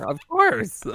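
jokey high squeak; mock high voice. Real, not acted. Joking answer, high voice.

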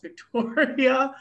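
slow, high, stretched-out jeer; mock drawn-out taunt. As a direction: stretch the word out as a teasing jab. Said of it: "he's like".

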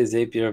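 "Zapier" pronounced ZAY-pee-er; "Zapier" starting like "zay", not "zap"; long-A pronunciation. Shown as a demonstration. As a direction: open "Zapier" with the vowel of "day". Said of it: "I always say Zapier".

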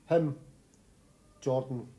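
usual speaking voice

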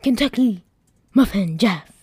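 loud, indignant telling-off; angry. Acted. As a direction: shout it in outrage at the computer. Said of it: "I said angrily toward the computer".

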